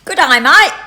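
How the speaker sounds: loud Aussie greeting, rising on "mate"; Australian accent. Shown as a demonstration. Nanny's next try at the challenge, loud Australian. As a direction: call it out in broad Australian. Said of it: "trying to do an Australian accent".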